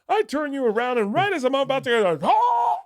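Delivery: very high, excited bit ending in a yelp; mock show contestant. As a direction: act out the contestant, climbing to a cry. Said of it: "I go".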